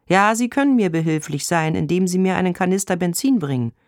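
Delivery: lightly voiced hopeful request. A narrator's reading. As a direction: ask with a touch of rising hope. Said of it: "Seine Stimme klingt hoffnungsvoll".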